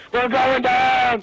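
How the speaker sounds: high panicked scream; panicked yell. Real, not acted. Helicopter crashing, panic.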